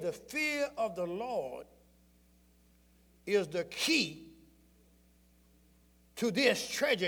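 slow, weighty scripture reading; drawn-out reverent reading; solemn slow reading. Real, not acted. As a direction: let each phrase land slowly.

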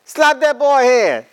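high, slow, loud mock old lady command; mother-in-law's order. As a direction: drag it out like a bossy grandmother.

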